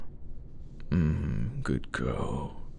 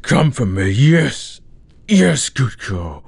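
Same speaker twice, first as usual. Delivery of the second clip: higher, louder, excited urging; 7 semitones higher, 6 dB louder than usual. Acted. Urging his partner to climax, loud and excited. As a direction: push her over the edge, louder.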